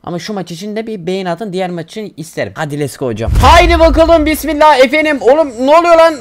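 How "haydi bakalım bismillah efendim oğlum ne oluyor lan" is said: high, loud, fired-up launch into the match; loud hype at the drop; excited shout. Real, not acted. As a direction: rev up the squad like the match is starting.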